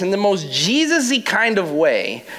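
ordinary talk, nothing marked

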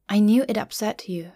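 ordinary delivery

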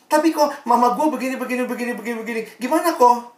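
high-pitched imitation of a complaining teenager; mock whiny objection; protesting teen. Voicing a teen protesting the advice.

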